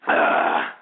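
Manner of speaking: breathy ahh after a long swig; satisfied gasp. Real, not acted. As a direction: gasp for air after chugging. After chugging beer.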